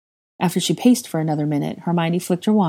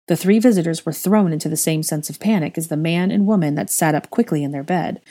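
usual speaking voice

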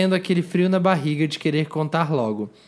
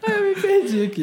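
laugh-crying. Real, not acted. Lost in laughter.

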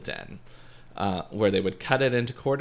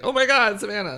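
shocked exclamation; 11 semitones higher, 4 dB louder than usual. Pretend. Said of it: "you're gonna be like".

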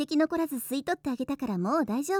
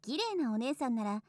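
soft and tender; 7 dB quieter than usual. Acted. Soft intimate talk.

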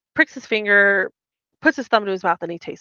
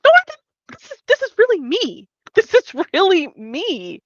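higher, louder pleading denial; hammed-up hurt protest; upset denial; 10 semitones higher, 8 dB louder than usual. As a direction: insist it's really her, voice climbing. Voicing Leia in an argument.

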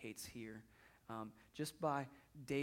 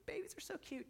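high cooing gush over babies; cooing baby voice. Pretend. Mocking churchgoers who gush, in a high cooing voice. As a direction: coo it like a doting aunt. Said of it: "some of you guys are like".

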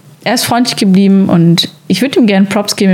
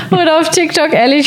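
laughing; 9 semitones higher than usual. Real, not acted. Laughing at friend's joke.

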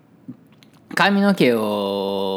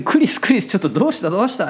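higher, louder calling of the name; urgent calling out; 8 semitones higher, 4 dB louder, faster than usual. Retold or imitated. Hairdresser calling out to the tearful father, urgently. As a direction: call him back to his senses.

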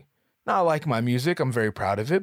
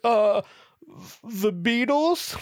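much higher, halting, unsure; sheepish guess; 10 semitones higher, 4 dB louder, much slower than usual. Pretend. Narrator imagining a lame answer to the host, sheepish. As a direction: offer it like a nervous guess.

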